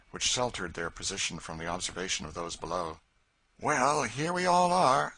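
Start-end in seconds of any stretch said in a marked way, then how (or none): 3.6-5.1 higher, louder and slow, pleased with himself; a dry, satisfied Quaker; Phineas, the Quaker guide. A narrator's reading. Phineas to the party once safe on the rock, satisfied.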